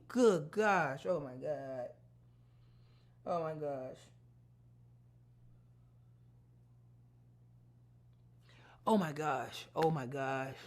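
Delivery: low, hushed, stunned repetitions; breathless with awe, barely voiced; awestruck dread. Real, not acted. Overwhelmed by the vision.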